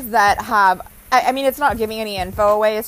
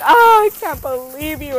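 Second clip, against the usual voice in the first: higher, louder theatrical disbelief; mock indignation; 6 semitones higher, 6 dB louder than usual. Wife to husband mid-fight, higher mock indignation. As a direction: huff it like a drama queen. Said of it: "And I'm like".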